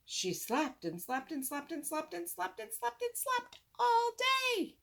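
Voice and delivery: high mock sleepy storyteller. Boy mocking a dull story, high mock voice. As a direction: lull it out like a dull bedtime tale.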